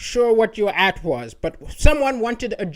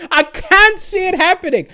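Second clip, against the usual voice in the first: ordinary talk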